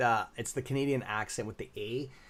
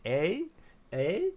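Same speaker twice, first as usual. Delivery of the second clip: Canadian "eh?", pitched up with a rising questioning lilt; 5 semitones higher than usual. Shown as a demonstration. Streamer joking about pronunciation trouble, showing off the Canadian "eh". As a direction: toss out a playful Canadian "eh?". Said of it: "It's the accent, the A accent".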